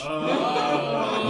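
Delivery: normal talking voice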